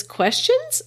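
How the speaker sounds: sharp high rise on a single word; incredulous echo. Acted. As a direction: toss the word back in disbelief. Al answers her incredulously.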